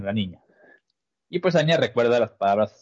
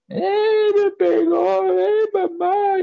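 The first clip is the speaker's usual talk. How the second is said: high, loud whiny wail; high hammed-up boy crying for mom; mock crying kid; 4 dB louder, much slower than usual. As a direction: wail it like a hurt six-year-old. Boy crying after punch.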